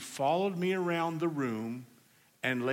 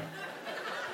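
laughing; 11 dB quieter than usual. Real, not acted. Laughs at own story.

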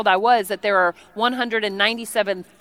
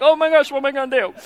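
quick, rushed flutter of panic; mock panic; 3 semitones higher, faster than usual. Shopper without cash at the register, rushed mock panic. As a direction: rattle it off as if panicking. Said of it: "You panic".

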